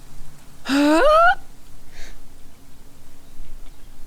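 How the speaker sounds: long high gasp; dramatic gasp. Acted. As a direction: gasp in mock outrage. Mock outrage at the demand.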